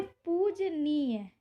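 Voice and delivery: पूजनीय said slowly and softly; slow dictation. Shown as a demonstration. Teacher slowly reads the word पूजनीय to students. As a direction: draw out पूजनीय syllable by syllable. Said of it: "अशुद्ध में दिया गया है पूजनीय".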